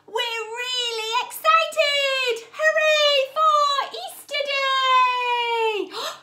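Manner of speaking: excited cheer. Acted. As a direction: cheer it out, stretched. Story refrain cheered.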